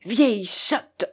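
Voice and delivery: slow, scornful outburst; indignant exclamation; sudden exclamation. A narrator's reading. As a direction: burst out with drawn-out self-reproach. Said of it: "elle s'écria tout à coup".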